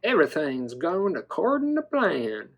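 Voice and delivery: "plan" stretched to a southern "plain"; playful dino voice, southern accent. Pretend. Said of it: "in a southern accent".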